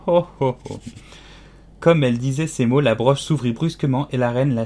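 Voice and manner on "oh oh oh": pained string of ohs; wailing cries. A narrator's reading. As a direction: wail in anticipation of pain. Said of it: "Ses cris ressemblaient si exactement au sifflet".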